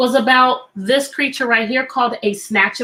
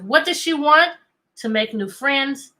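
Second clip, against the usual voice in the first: normal talking voice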